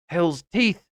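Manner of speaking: exclaimed oath with a touch of force; mild narrated outcry; cried out. A narrator's reading. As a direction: cry out, moderately, in frustration. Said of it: "cried Ned Land".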